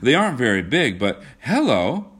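acted enthusiasm breaking into surprise; enthusiastic. A narrator's reading. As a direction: lift the pitch, caught by a discovery. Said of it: "cried John enthusiastically".

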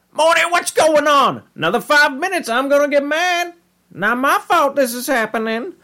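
very high, hammed-up teacher outburst; Mister Goldenfold. Teacher warns his pupil in a dream, high and hammed-up. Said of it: "he's just all like".

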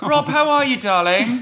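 high, theatrical darling greeting; mock posh greeting. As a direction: gush over him like a diva. Mock greeting to friend Rob.

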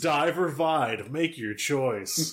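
slow, dramatic game announcer; fighting-game announcer. Pretend. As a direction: announce a character-select screen, drawn out. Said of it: "Japanese fighting game before you select a character".

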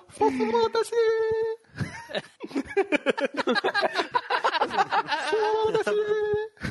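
high, laughing mock outburst; mock exclamation. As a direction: squeal it out between laughs. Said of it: "Vai mandar um".

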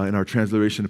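ordinary talk, nothing marked

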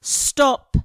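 put-on a sudden, loud shout at a crying child; father losing his temper, shouting at his baby; furious shout. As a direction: bark it out in a burst of anger. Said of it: "he shouted".

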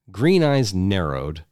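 ordinary delivery

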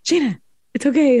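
high, soothing quote of a calm friend. Retold or imitated. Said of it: "she was like".

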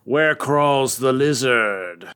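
slow, drawn-out story title; dramatic title read. Acted. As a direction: announce it slowly like a spooky title.

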